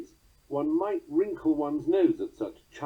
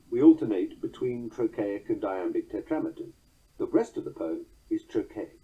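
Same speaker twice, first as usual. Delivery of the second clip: ordinary talk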